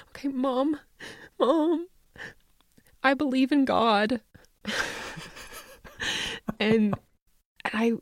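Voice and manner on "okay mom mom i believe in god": put-on higher, hesitant child confessing; daughter's confession. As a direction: play the nervous daughter blurting it out. Daughter voiced in the story.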